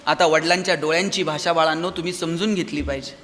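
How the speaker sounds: low, soft and earnest; tender counsel. Real, not acted. Speaker urging children to read their father's eyes, tender counsel. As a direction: drop the voice into gentle fatherly advice.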